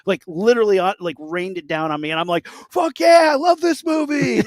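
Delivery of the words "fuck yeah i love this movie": shouting with gleeful excitement, much higher and louder; excited shout. Retold or imitated. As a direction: yell it like a thrilled teen at a gore scene. Excited shout in gory movie story.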